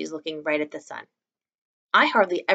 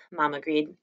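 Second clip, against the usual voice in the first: usual speaking voice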